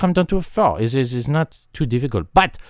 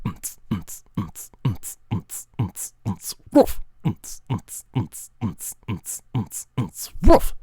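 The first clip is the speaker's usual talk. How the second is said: low, hushed repeating beat with woof accents; steady quiet mouth percussion; beatbox; 5 semitones lower, 4 dB quieter, slower than usual. Shown as a demonstration. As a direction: thump softly in a loop, bark at each end. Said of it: "If you go".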